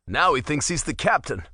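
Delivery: plain reading voice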